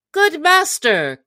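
high, slow storybook exclamation; alarmed cry. A narrator's reading. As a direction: cry out to your master in a high voice. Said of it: "cried Will".

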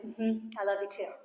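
soft, easy reply; relaxed listening. Acted. Believer sitting and listening to God, relaxed and soft. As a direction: answer quietly, at rest. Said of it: "I'm sitting, I'm listening".

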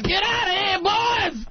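put-on slow hollering old miner; old prospector. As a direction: yell it like a grizzled prospector. Joking about old gold miner.

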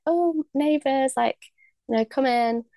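high, slow, gushing hello to new neighbours; excited greeting. Retold or imitated. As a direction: greet the neighbours brightly and drawn out. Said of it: "I was really excited".

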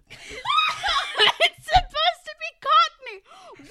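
loud, high, drawn-out laughing shriek; real laughing outburst. Real, not acted. Telling co-host about the bad accent, shrieking with laughter. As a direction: squeal it through helpless laughter.

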